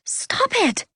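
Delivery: very high, distressed plea; shocked gasp. A narrator's reading. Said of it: "Anna gasped".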